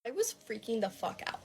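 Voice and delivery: plain reading voice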